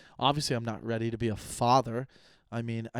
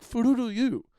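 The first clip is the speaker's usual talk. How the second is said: much higher, louder silly jab; mock his mom's made-up curse; mock insult; 11 semitones higher, 5 dB louder than usual. Mocking mom's word, loud silly jab. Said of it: "Be like".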